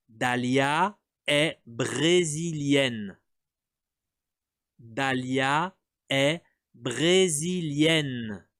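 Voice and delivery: very slow, drawn out for repetition; slow model sentence. Shown as a demonstration. Teacher modelling for repetition, very slow and drawn out. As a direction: stretch it out so learners can follow. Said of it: "Répète".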